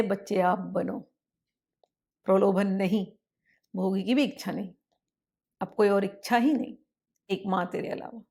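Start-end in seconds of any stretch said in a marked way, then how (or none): none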